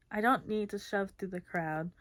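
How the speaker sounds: plain reading voice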